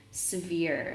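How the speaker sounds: ordinary delivery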